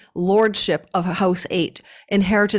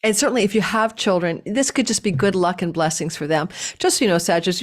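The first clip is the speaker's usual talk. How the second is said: normal talking voice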